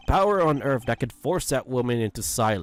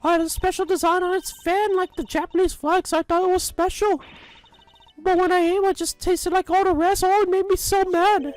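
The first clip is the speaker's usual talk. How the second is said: much higher, indignant granny rant; grumbling old lady. Acted. Old lady complains everyone looks down on her, high huffy granny voice. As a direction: whine like an offended old busybody.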